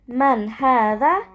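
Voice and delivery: من هذا with a rising question tune; question intonation. Shown as a demonstration. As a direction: ask من هذا with the voice lifting. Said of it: "Again, with the intonation".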